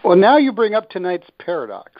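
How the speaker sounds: usual speaking voice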